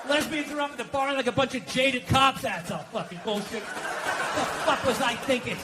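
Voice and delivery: ordinary delivery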